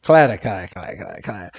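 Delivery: low, soft, clipped alien clatter; a muttered, deep-pitched alien noise; alien chatter. Retold or imitated. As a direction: rattle it off like a gray space alien. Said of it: "And they're like".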